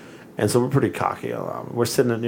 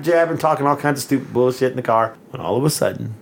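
ordinary delivery

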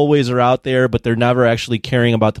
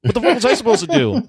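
exasperated retort; 11 semitones higher, faster than usual. Retold or imitated.

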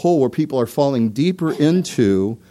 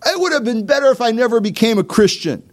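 much higher, complaining put-on voice; mock regretful believer, pitched high; whiny inner doubter; 10 semitones higher, 3 dB louder than usual. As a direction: voice the regretful believer's self-pity. Voicing a doubter's inner thought.

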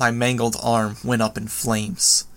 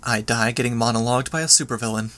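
usual speaking voice

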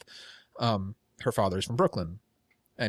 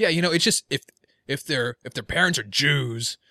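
loud, hostile edge on every word; hate monger voice; 5 semitones higher, 5 dB louder than usual. Retold or imitated. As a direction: sound like you secretly resent them. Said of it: "I sound like a hate monger".

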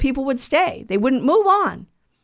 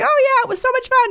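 very high, gushing and carefree; giddy childish glee; 14 semitones higher than usual. Acted. Sinners coming back to God, gushing in a very high childish voice. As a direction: gush like a kid who just had a blast. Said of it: "we're like".

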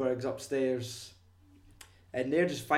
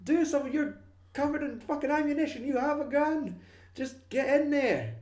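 high and loud, pleading at the screen; frustrated yelling; 12 semitones higher, 3 dB louder than usual. Acted. Friend shouting at Upham on the stairs, high frustrated yell. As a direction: yell at him to get up the stairs.

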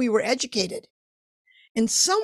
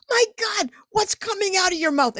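much higher, startled self-questioning; alarmed inner voice; 9 semitones higher than usual. Retold or imitated. Young artist hearing himself pitch big ideas, high and startled. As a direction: react in disbelief to your own words. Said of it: "I go".

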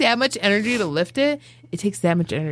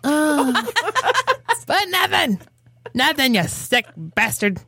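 show intro announcer; 4 semitones higher than usual. Acted. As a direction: open the show like a hyped-up announcer.

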